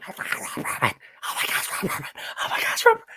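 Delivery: breathless fanboy whisper, building to a squeal; starstruck whisper. Retold or imitated. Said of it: "Tom is basically going".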